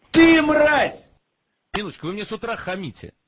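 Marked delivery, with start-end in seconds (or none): 0.1-1.1 loud, high angry yell; angry shout. Real, not acted. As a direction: shout the insult in fury. Shouted insult on call.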